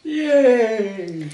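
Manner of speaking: high, long drawn-out cheer. Real, not acted. Dad cheering in the background, high-pitched yay. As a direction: stretch it out high, sliding down.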